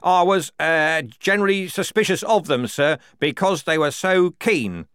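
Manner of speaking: loud, high delivery over noise. A narrator's reading. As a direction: pitch it up to be heard. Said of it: "had to raise his voice".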